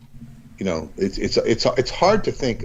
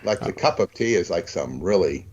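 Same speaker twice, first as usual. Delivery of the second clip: normal talking voice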